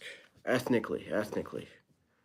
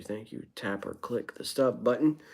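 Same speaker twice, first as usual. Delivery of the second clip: ordinary delivery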